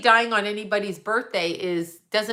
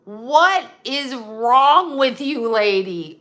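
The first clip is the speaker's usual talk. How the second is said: higher, louder outraged tirade, slowing on "What is wrong with you"; imagined angry rant; 4 semitones higher, 6 dB louder than usual. Retold or imitated. Telling off the pharmacy woman about vaccines, in loud outrage. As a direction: let fly at the stranger, voice up and loud. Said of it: "it was all I could do not to go".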